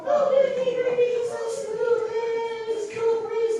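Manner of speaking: very high, sing-song mother impression; mother's voice. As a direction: chirp it like an excited mom gushing. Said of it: "My mother was always talking about".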